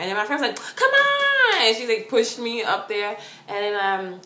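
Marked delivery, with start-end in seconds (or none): 0.8-1.6 high, loud drawn-out yell; impatient urging. Retold or imitated. Friend pushing her up there, impatient yell. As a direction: imitate a friend yelling you onward.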